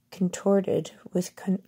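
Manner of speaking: ordinary delivery, nothing marked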